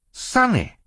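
put-on high-pitched girl's cry of reproach; shocked exclamation. A narrator's reading. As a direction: exclaim the name high and startled. Sister scolds biting baby.

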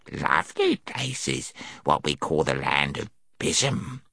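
low, soft, put-on gnome voice; gnome storyteller. A narrator's reading.

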